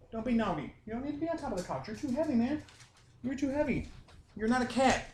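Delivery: high-pitched, light pet-scolding voice; real, gentle telling-off in a high voice; scolding the dog. Real, not acted.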